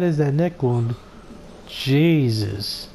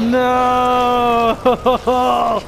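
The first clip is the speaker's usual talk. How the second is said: real fright bursting out; startled shout; 9 semitones higher than usual. Real, not acted. Zombie jump scare.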